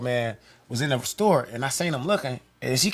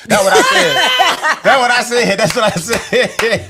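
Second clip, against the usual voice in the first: laughing; 6 semitones higher, 8 dB louder, slower than usual. Real, not acted. Laughing recounting own line.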